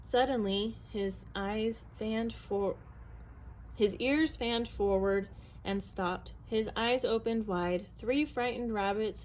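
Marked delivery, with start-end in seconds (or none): none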